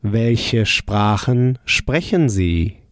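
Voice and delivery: slow, clear "Welche Sprachen sprechen Sie?"; polite question said slowly for repetition; unhurried teacher model; slow model answer. Shown as a demonstration. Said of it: "How would you say".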